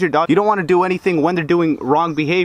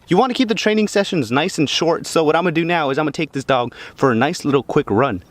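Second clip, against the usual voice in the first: normal talking voice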